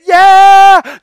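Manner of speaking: loud, high, drawn-out cheer; excited cheer. Retold or imitated. Dad cheering his son's first steps, loud excited shout. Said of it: "We were so excited".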